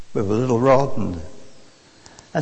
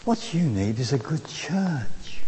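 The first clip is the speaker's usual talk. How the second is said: slow, hushed advice; quietly said; 4 semitones lower, 7 dB quieter, slower than usual. Retold or imitated. Preacher recalls advising a lonely traveller, quietly. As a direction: lower it almost to a whisper, slowly. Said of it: "I quietly said".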